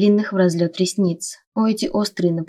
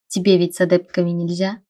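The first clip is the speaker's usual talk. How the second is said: usual speaking voice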